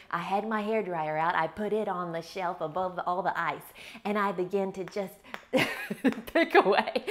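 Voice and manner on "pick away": giggling through the words, higher and louder; laughing. Real, not acted. Telling about chipping freezer ice, laughing. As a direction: laugh as you say it.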